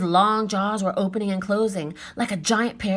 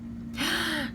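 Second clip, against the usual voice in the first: soft awed gasp; 7 semitones higher, 7 dB quieter than usual. Acted. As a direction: gasp at the sight. Seeing the dinosaur.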